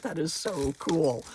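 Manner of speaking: ordinary talk, nothing marked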